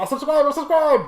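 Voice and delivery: high, loud mock desperation; panicked yelling. Beaten man pleading with his chip, panicked yell. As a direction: yell it frantically, hammed up. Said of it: "He's like".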